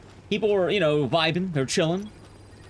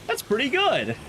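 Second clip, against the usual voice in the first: pitched-up dawning approval; pleasantly surprised; 9 semitones higher than usual. Retold or imitated. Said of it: "I thought to myself".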